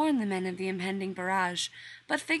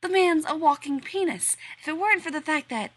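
high, loud indignant rant; 9 semitones higher, 7 dB louder than usual. Acted. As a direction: keep ranting, indignant. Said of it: "Rodney continued muttering to himself".

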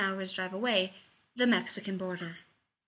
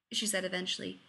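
ordinary delivery

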